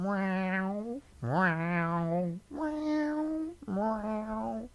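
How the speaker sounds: high, long yowling cat meows; stretched-out cat yowls; yowly meows. Shown as a demonstration. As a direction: yowl each meow up high like a cat. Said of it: "Many of them are very yowly".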